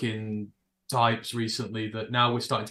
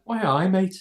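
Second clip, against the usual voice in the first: higher, louder mock Scouse accent; Scouse attempt; 7 semitones higher, 4 dB louder than usual. Shown as a demonstration. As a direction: throw out a cheeky Scouse greeting. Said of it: "I can do Scouse pretty well".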